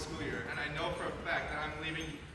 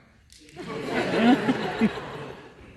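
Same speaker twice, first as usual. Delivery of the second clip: laughing through tears; embarrassed choked-up laugh; tearful laugh; 6 semitones higher, 14 dB louder than usual. Real, not acted.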